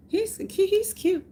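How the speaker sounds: high, giddy aside; gushing. Real, not acted. Fan calling Mike Baker cute in high giddy tone. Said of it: "gushing about a stranger".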